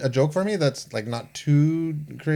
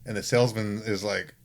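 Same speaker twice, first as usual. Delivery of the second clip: ordinary talk, nothing marked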